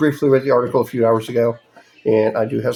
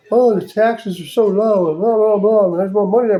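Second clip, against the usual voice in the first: mocking; 6 semitones higher than usual. Mocking what people think.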